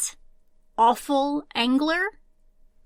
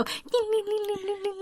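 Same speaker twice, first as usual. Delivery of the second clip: high, soft, rippling "ling" tinkle; imitated wind chimes; 9 semitones higher, 6 dB quieter than usual. Cohost mimics someone messing with the chimes, tinkling high. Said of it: "and you just go".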